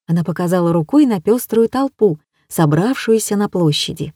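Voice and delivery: plain reading voice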